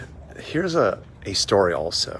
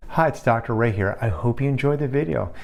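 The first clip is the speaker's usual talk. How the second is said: higher, upbeat promo voice; ad read; 6 semitones higher than usual. Acted. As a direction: sell the free call with cheer.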